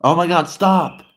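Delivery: loud, high-pitched exasperated plea. Real, not acted. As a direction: snap at the echo, loud and high. Fed up with echo repeating him.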